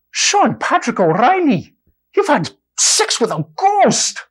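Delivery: put-on high, slow Irish accent, appalled; a scandalized Irish priest; stage Irish brogue, shocked. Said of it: "use of the Irish accent".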